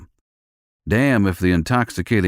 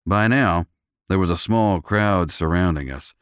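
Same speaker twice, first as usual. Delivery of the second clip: ordinary delivery, nothing marked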